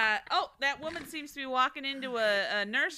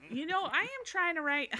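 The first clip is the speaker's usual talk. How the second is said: put-on Texan accent; 7 semitones higher than usual. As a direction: talk like a Texan. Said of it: "now I'm going Texan".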